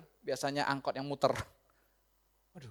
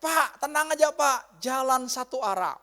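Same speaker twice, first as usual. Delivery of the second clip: high, loud call to a driver; acted shout of reassurance, drawn out; 7 semitones higher, 7 dB louder, slower than usual. Bystander calling out that the road is one-way, shouting. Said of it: "Ada yang teriak".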